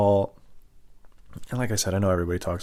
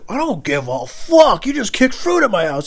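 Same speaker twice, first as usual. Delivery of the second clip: high, loud drawn-out swearing; furious yelling; 13 semitones higher, 7 dB louder, slower than usual. Retold or imitated. Driver cuts off victim's excuse, furious high yelling. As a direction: bellow it back, stretching the swear. Driver yells back in quarrel.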